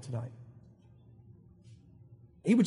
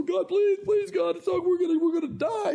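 high, frantic mock wail; hammed-up desperate begging, pitch held high; panicked pleading; 9 semitones higher than usual. Moses begging God not to let them die, hammed-up panic.

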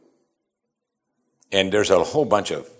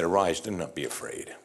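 gentle reassurance; 5 semitones lower, 8 dB quieter than usual. Retold or imitated. Jesus comforting frightened disciples.

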